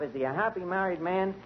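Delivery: ordinary talk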